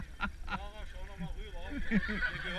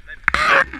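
much higher, much louder call; shouted correction; 22 dB louder, slower than usual. Real, not acted. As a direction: yell it so they stop. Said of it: "Bleib liegen, bleib stehen".

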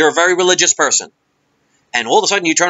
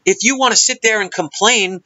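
ordinary talk

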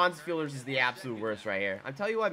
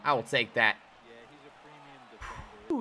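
usual speaking voice

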